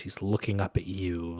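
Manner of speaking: ordinary delivery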